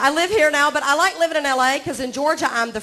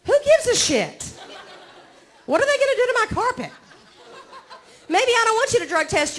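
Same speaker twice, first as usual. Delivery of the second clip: high, fast, laughing outrage; indignant rant; 8 semitones higher than usual. Real, not acted. Comic mocking a carpet cleaner ad, high indignant outburst. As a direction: scoff at the ad in mock disbelief.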